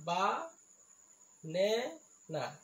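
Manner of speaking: "বা-, নে-, না" as three separate beats; syllable by syllable. Shown as a demonstration. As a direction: pause after each syllable of "বা-, নে-, না". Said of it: "ইয়াত তিনিটা চিলেবুল আছে". ("বা-, নে-, না" তিনিটা ভাগত ভাঙি)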